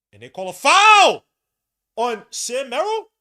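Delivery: very loud, high-pitched disbelief; outraged yell. Real, not acted. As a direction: shout it in protest at the ref. Outraged at referee's call.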